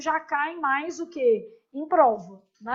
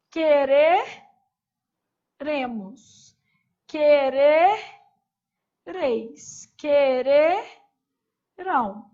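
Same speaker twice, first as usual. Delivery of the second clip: quereremos drawn out and louder; slow, loud drill; 5 dB louder, much slower than usual. Shown as a demonstration. Teacher conjugating the future for students, slow and loud. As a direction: belt out each form slowly. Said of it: "Então vai ficar eu quererei".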